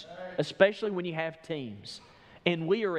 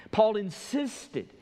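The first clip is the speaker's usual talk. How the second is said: "insisted" slow, with the middle syllable punched; high, deliberate, emphatic "insisted"; stress on insisted; 5 semitones higher, 4 dB louder, much slower than usual. Real, not acted. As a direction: hammer home "insisted" slowly. Preacher repeats the underlined word.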